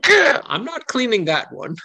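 high, drawn-out mock protest; indignant refusal. Stable helper glaring at the horse, high indignant refusal. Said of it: "just the angry eyebrows".

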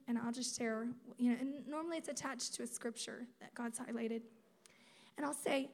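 ordinary talk, nothing marked

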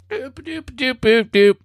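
high little doops; imitated beeping. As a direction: make quick, high electronic-style doops. Imitates a blinking noise, unnamed.

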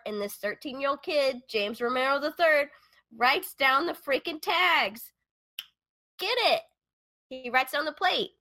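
high, delighted storytelling; excited. Real, not acted. Cheering on the boy's detective work.

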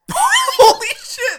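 high, loud, drawn-out burst of laughing disbelief; astonished shout. Real, not acted. Game master reacts to a huge roll, real astonished shout. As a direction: shriek it in real amazement.

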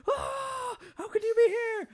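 high, loud shocked shriek as Doc; startled yell. Retold or imitated. Doc shrieking in shock at Marty showing up. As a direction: jump out of your skin at the sight.

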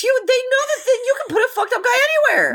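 high, fast giddy voice; high mock voice. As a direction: gush conspiratorially. Mock knowing voice.

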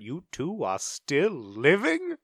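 slow, swooping film-quote impression; Grinch. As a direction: stretch out the mock disbelief, rising at the end. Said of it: "your favorite line from the Grinch".

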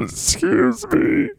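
high, tearful squeal; crying character. Acted. Mister Golf interrupts the podcast, crying in a high voice. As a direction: whimper it out in falsetto. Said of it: "are you already crying".

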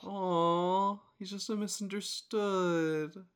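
high, cooing pity; mock sympathy. Co-host pitying the diary-writing shark, high cooing sympathy. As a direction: coo over the poor thing, drawn out.

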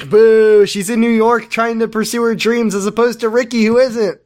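high mock indignation; mock outraged heckle. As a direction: heckle her like a jeering crowd.

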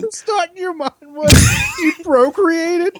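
high, slow mocking nerd voice; drawn out, mocking; mocking nerdy voice. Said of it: "What are you, fucking Bill Nye".

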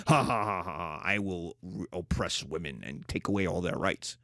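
low, soft evil villain laugh and vow; cartoon villain. Lecturer mocking the idea of a scheming oppressor, low villain gloat. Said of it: "some guy twiddling his mustache".

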